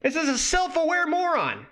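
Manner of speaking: high exasperated outcry; incredulous exclamation. Real, not acted. Ranting about the guide's writer, incredulous high exclamation.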